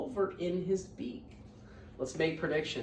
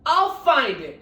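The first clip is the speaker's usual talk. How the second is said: loud, higher determined cry; 5 semitones higher, 16 dB louder, slower than usual. Acted. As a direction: vow it out loud. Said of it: "cried Horton".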